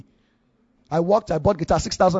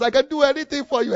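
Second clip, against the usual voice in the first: higher-pitched mock devotion; acted rapturous prayer; 6 semitones higher than usual. Worshipper on the bus promises God anything, acted rapture. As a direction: get carried away imitating worship. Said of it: "you must make careless statements".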